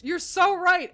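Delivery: high, loud emphatic agreement; reacting to a chat message, excited. Real, not acted. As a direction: burst out in agreement.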